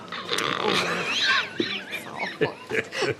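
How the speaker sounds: long, high-pitched peal of laughter. Acted. Friend cracking up at jugged hare joke, laughing.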